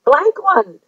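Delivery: mock-scold Amanda with delight; retold excited protest. Telling Amanda she did too much, delighted protest. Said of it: "I'm on the other end of the camera going".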